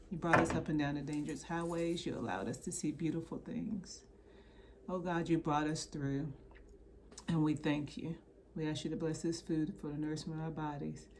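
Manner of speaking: quiet, earnest mealtime prayer. Acted. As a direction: pray softly over the food. Grace before the meal.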